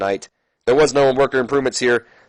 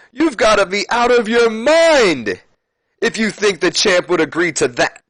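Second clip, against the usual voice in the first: much higher, slow mocking scoff; Flair scoffing; 9 semitones higher than usual. Flair laughing off Edge's challenge, hammed-up scoff. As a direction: scoff at the idea like it's absurd. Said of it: "Ric Flair starts to laugh at him".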